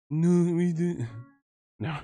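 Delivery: a hint of a tough fighter, badly faked; bad Chris Cyborg impression. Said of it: "That was a terrible Chris Cyborg impression".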